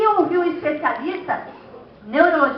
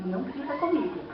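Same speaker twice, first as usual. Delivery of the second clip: much softer, held-back retort; 2 semitones lower, 9 dB quieter than usual. Acted. Preacher replaying the parent-child quarrel, a quiet retort. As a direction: keep it down, no shouting. Acted retort, set against the shout.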